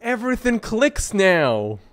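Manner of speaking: high, loud startled yell; real startled yo man; shocked shout. Real, not acted. Reacting to game twist.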